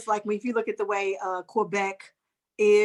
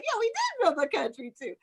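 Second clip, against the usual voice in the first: high mock agreement; 10 semitones higher than usual.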